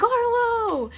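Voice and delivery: long high call across the room, loud; calling the dog's name. Retold or imitated. Entering the room, calling for her dog Arlo, loud call. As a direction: shout his name as if he'd come running.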